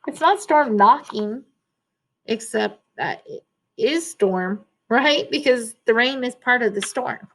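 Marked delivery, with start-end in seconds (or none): none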